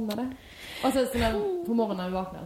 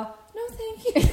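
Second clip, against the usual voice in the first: much higher, slow, laughing no thank you; mock polite refusal; 13 semitones higher, 3 dB louder, much slower than usual. Turning down the hotel staff, high and slow with a laugh. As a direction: sweetly turn them down, drawn out and high.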